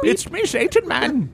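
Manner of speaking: put-on high old-timer voice; creaky prospector returns. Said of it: "You brought me back".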